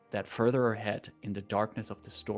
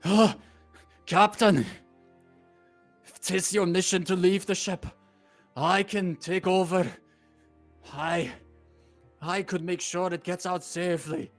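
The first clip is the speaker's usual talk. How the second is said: put-on high, loud old sailor voice; gruff old fisherman; 7 semitones higher, 6 dB louder than usual. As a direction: call up as a weary old sailor. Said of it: "The old sailor climbs up to you like".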